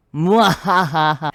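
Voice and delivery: slow, loud evil cackle; mad scientist laugh. Pretend. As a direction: cackle like a villain on Halloween.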